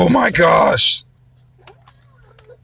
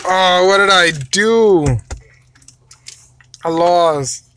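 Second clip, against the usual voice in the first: usual speaking voice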